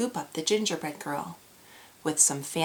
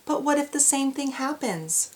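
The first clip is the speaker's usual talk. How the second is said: worried cry; 7 semitones higher than usual. Acted. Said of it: "cried the old woman".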